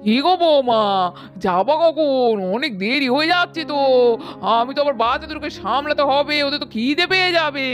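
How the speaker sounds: put-on an old mother-in-law hurrying the bride along. Mother-in-law calls daughter-in-law.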